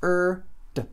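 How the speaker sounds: long "Er", then a detached short "D"; sound by sound. Shown as a demonstration. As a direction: sound out "Er" and "D" separately. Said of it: "Só tem três sons".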